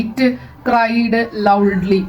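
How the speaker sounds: slow word-by-word. Shown as a demonstration. Teacher repeating an example sentence to students, slow word-by-word.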